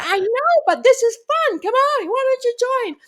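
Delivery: high, bubbly grandma coaxing; playful grandma. Retold or imitated. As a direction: coax her to join the fun. Grandma voiced inviting to dance.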